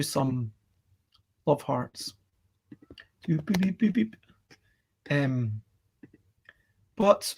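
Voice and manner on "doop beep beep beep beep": soft electronic beeping noises; imitated beeps. Doing love hearts for the chat, soft playful beeps. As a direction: quietly voice little beeps.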